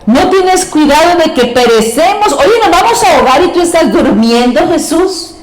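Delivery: high, frantic plea to a sleeping master; panicked disciples. Acted. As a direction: cry out in alarm, voice up high. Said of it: "le despiertan y le dijeron". (discípulos aterrados, voz aguda y urgente)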